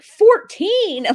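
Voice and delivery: high, loud incredulous mimicry; imitated shock. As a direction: act out a stunned dispatcher exclaiming. Dispatcher's shocked reaction retold.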